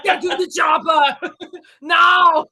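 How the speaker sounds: loud, high Arnold catchphrase shout; Arnold accent. Acted. Contestant slipping from German into Arnold, shouted Arnold accent. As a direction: yell it in a hammed-up Austrian Arnold accent. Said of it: "official Arnold accent achieved".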